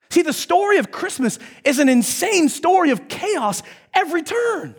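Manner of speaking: ordinary delivery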